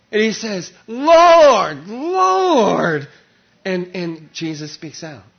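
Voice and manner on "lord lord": loud, high pleading cry; terrified cry. Acted. Saul struck down, crying out to the Lord in fear. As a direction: cry out in dread, breaking.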